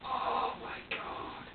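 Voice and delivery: slow, drawn-out whisper; exhausted whisper of despair; whispered oh my God. Retold or imitated. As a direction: let it slip out under your breath, slowly. Overwhelmed, recalling the baby's screaming.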